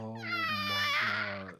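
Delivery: very high, drawn-out cry of disbelief; long, slow real wail of shock; scandalized. Real, not acted. Co-host hearing a dark joke, scandalized.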